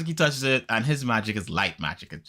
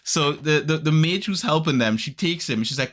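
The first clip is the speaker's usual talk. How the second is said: usual speaking voice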